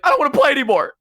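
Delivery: high, petulant mock-child protest; whiny kid quitting. Imitating childhood self giving up wrestling dad, high whine.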